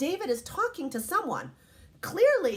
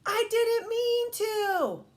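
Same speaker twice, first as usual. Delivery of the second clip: put-on high, loud, drawn-out kid plea; stretched-out little-boy protest; David's excuse; 11 semitones higher, 5 dB louder, much slower than usual. A narrator's reading. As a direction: whine it out like a child caught misbehaving. Voicing David caught in trouble.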